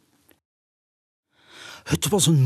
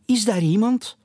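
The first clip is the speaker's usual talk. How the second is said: higher, raised call into the castle; 10 semitones higher, 3 dB louder than usual. Acted. Owl calling into the dark castle, raised voice. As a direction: call out into the empty ruins. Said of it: "riep Jodocus".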